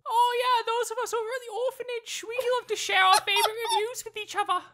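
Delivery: high, laughing mock cockney orphan voice; hammed-up cockney kid, giggling through it; terrible cockney accent. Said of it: "my terrible accent".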